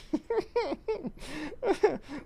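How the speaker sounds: high, quiet nervous giggle; nervous laugh. Real, not acted. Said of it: "that's a nervous laugh".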